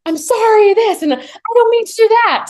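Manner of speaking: high, loud mock screaming apology; mock screamed apology. Brothers scaring off bears, mock screaming apologies. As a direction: yell the sorry like the brothers in the woods. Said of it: "screaming, apologizing to each other".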